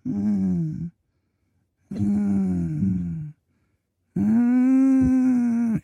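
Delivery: high whimpering mm sounds, growing; imitated dog whine. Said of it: "he goes".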